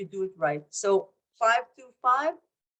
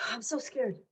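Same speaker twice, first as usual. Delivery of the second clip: softer, understated frightened confession; mildly acted fear; 7 dB quieter than usual. Frightened person on the border, softly scared. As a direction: let a little fear hush the voice.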